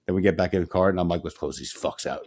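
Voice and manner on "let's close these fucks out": mock tough growl. Retelling own car pep talk.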